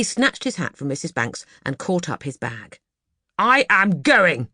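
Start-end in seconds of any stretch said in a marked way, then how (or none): 3.4-4.5 slow and much louder, firm; stormy exit. Acted. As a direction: announce his departure in anger. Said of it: "he said".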